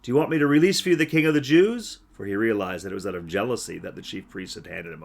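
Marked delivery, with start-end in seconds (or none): 0.0-2.0 louder, quicker Pilate appeal; Pilate to the crowd. Acted. Pilate calling out to the crowd, louder and faster. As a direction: put it to the crowd, fast. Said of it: "he answered them".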